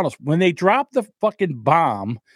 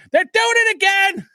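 they're doing it again, jumped much higher in pitch; outraged repeat. Real, not acted. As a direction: throw the repeat up high, exasperated. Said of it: "and they're doing it again".

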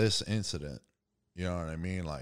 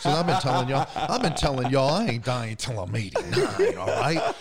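loud, laughing boast, higher and louder; laughing while joking; 9 semitones higher, 11 dB louder than usual. Real, not acted. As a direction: laugh through the brag about living long. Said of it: "I'm gonna bust some jokes".

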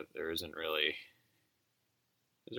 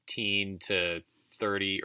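ordinary delivery

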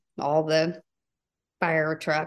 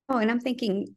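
ordinary delivery

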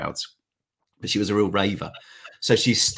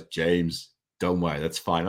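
lightly imitating a calm American uncle, a bit lower; a mild fatherly American reassurance, pitched down; uncleish American voice; 5 semitones lower than usual. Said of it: "in his sort of uncleish, uh, American voice".

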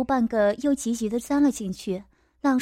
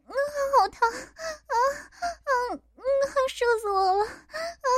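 moaning; 4 dB quieter than usual. Acted. Woman climaxing in sex scene.

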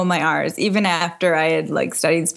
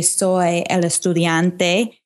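slow Spanish phrase; much slower than usual. Shown as a demonstration. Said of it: "you could say".

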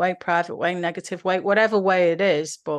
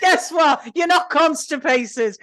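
high loud laughing quip; joking laughing; 8 semitones higher, 6 dB louder, slower than usual. Real, not acted. Co-host riffing on the nurse, loud laughing quip. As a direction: joke it out while laughing.

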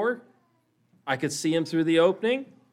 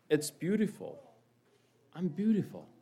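high, soft amazement; hushed wonder; 3 semitones higher, 6 dB quieter than usual. A narrator's reading. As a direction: let the discovery sink in, softly and high. Teacup awed by its own beauty.

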